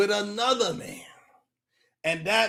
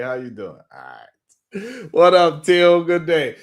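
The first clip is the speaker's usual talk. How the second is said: ordinary delivery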